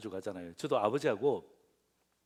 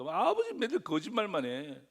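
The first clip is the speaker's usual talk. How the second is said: put-on complaining child; 7 semitones higher, 3 dB louder than usual. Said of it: "나오면서 어떡합니까?".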